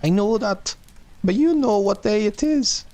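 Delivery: put-on high falsetto old woman's voice; old lady asking. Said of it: "she said again".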